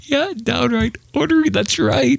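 squeaky amused echo; high mock echo. Shown as a demonstration. Echoes phrase in high voice.

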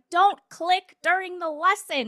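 a hint of a scolding teacher; mock stern teacher. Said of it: "She's like".

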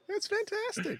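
sudden high-pitched excited squeal; squealed delight. Real, not acted. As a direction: shriek it with glee. Repeats praise, second take squealed.

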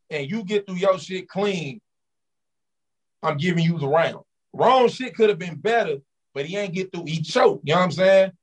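ordinary delivery

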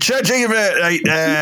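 high-pitched mock Trump that slips toward Irish; a wobbly, hammed-up go at a president's voice, drifting Irish; Trump impression. Mate retelling Trump's arrival, a high mock president voice. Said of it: "It sounded like you were Irish there".

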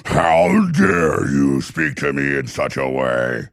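evil queen. Retold or imitated. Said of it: "This is how a woman sounds".

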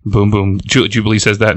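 ordinary talk

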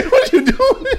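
squeaky, startled question; surprised high voice. Pretend. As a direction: ask in shocked disbelief.

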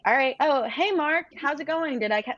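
a sales rep showing the confident, friendly way to open a call; confident casual opener. Shown as a demonstration. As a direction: sound like you're calling your best friend. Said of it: "make it less robotic".

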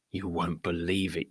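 low, hushed mock disbelief; listener's imagined reply. Coach voicing a sceptical listener, hushed and low. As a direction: murmur it like a doubtful listener. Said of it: "you say".

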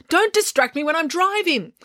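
high, exasperated scolding; cross scolding. A narrator's reading. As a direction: scold while busy driving. Flustered driver scolds April.

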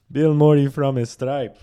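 laughing it out, high and loud; real laughter through the quote. Real, not acted. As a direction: crack up while repeating it.

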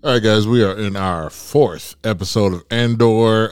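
normal talking voice

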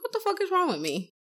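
high-pitched self-mocking question; baffled at yourself, pitched high; exasperated. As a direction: throw your voice up in mock despair. Acted exasperation at own choices.

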